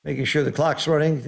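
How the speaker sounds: usual speaking voice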